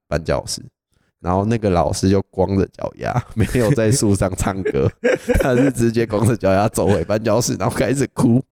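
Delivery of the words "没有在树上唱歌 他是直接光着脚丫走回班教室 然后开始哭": telling it through real laughter; laughing. Real, not acted. Student recounting a prank on the barefoot teacher, laughing. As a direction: barely hold back giggles while recounting.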